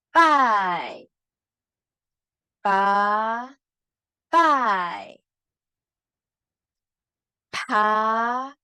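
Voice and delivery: "pa, pai" said with a strong puff of air; pinyin syllables drill. Shown as a demonstration. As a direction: read each syllable loudly for students to repeat. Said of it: "keluarkan angin".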